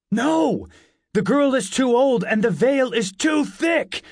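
loud, high-pitched shout; desperate shout. A narrator's reading. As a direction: shout it out to stop him. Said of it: "I shouted".

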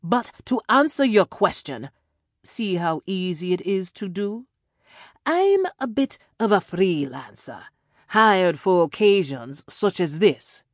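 put-on loud, high villain with a European accent; European-accented freelancer explaining himself grandly; hard-to-place European accent. A narrator's reading. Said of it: "a hard-to-place European accent".

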